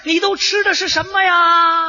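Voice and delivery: high, very stretched-out call. Acted. Soldier claiming kinship across the lines, calling out. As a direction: holler it with long drawn vowels.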